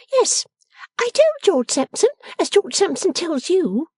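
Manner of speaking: very high-pitched pert sister; triumphant Lavvy. A narrator's reading. Miss Lavvy citing George as witness, pertly triumphant. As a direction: crow it in a high voice.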